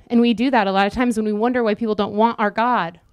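usual speaking voice

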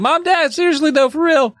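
a hammed-up squeaky kid pleading; whiny kid. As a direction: beg your parents like a scared boy. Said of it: "He's like".

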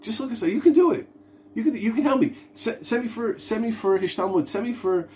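high-pitched begging employee voice; pleading lowly worker. Acted. Worker about to be fired begs the boss, pleading. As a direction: beg the boss for mercy in a small, high voice. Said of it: "he goes to the boss".

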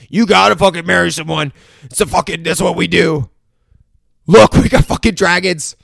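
high, loud exasperated yelling; mock screaming. King arguing with his daughter about marriage, mock yelling. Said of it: "there's, like, screaming about it".

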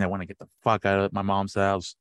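ordinary talk, nothing marked

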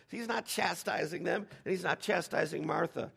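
ordinary delivery

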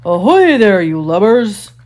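big, loud pirate hail; booming mock-captain call; Captain Hook. A narrator's reading. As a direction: call across the water as a commanding captain. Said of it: "made himself sound like Captain Hook".

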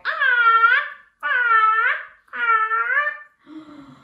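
high, loud cawing crow. Pretend. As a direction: caw like a crow. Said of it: "get your wings again and say".

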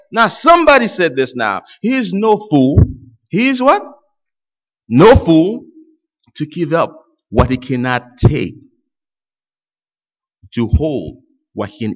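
ordinary talk, nothing marked

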